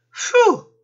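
high, loud puff of relief; puffed phew. A narrator's reading. Panda escapes the peacock, puffing phew. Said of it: "Panda puffed".

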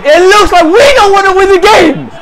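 high, angry yell; yelled in real exasperation; frustrated shout. Real, not acted. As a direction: repeat the complaint as an outburst.

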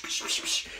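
whispery psh gunfire noises; imitated gunshots. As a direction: fire off quick unvoiced psh shots.

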